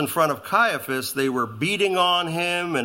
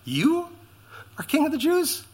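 scoffing disbelief; 13 semitones higher than usual. Retold or imitated. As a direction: sneer at a beaten prisoner's claim. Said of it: "fierce revolutionary".

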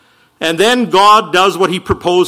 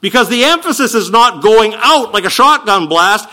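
ordinary delivery

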